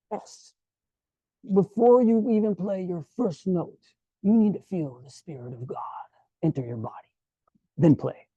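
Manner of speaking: put-on hushed, accented maestro with dramatic pauses; [name] accent. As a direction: instruct solemnly in an imitated maestro accent, part whispered. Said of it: "I'll do my best [name] accent".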